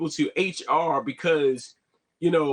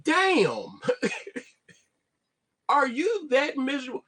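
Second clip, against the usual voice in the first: incredulous disbelief; 9 semitones higher than usual. Real, not acted. Reacting to snitching story.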